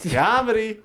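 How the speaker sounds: high, loud comic catchphrase; Mark Normand. Retold or imitated. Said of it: "I was like".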